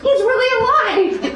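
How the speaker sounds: high, loud, overjoyed shouting; the crowd seeing Lazarus alive, cheering; excited cry. Retold or imitated. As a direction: burst out with joy at the news. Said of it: "They're like".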